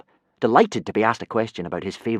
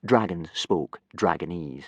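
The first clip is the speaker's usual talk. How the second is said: usual speaking voice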